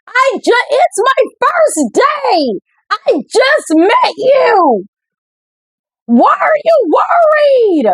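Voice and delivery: high, rising panicked protest near tears; upset protest. Acted. New girl protests to the mean girls, high and tearful. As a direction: blurt it out, flustered and close to crying.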